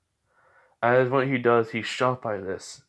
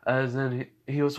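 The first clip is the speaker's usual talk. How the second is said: plain reading voice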